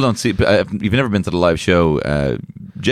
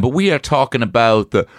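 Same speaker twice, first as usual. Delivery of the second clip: ordinary talk, nothing marked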